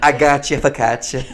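put-on laughing New Jersey housewife accent. Playing along with co-host, mock New Jersey housewife accent. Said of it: "We're New Jersey housewives together".

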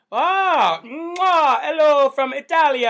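playful Italian air-kiss hello; mock Italian greeting. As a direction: blow an Italian kiss and greet them big. Greeting viewer from Italy.

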